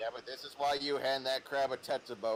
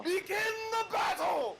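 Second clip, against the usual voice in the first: battle cry; 3 dB louder than usual. Pretend.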